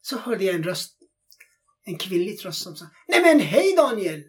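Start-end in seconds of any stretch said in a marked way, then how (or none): 3.1-4.2 high, loud cheerful hello; excited greeting. Retold or imitated. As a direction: call out with delighted surprise. Woman greets him in street. (glad överraskad hälsning)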